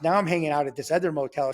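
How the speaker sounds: ordinary talk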